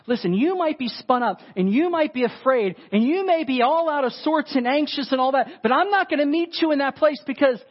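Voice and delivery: high, emphatic insistence as God; animated, insistent divine rebuttal; firm reassurance. Acted. Acting God's firm answer to Elijah.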